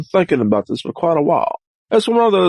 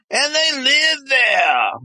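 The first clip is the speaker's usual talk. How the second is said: shout; 12 semitones higher, slower than usual. Acted. High shouted line.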